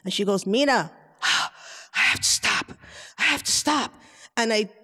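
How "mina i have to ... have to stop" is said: huffing and puffing imitation of mom; acted out of breath. Mother stopping on a walk with her daughter, mock breathless. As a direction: gasp out the words between heavy breaths. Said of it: "she's totally out of breath".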